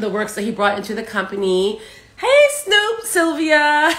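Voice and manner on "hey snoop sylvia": high, loud delighted call; excited greeting. Real, not acted. Host greets her cousin joining the live, excited. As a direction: call out to a cousin who just popped in.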